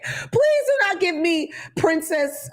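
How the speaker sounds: high, slow princess tone; mock princess. Mocking the agent, high slow princess tone. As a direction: stretch it high like a Disney princess.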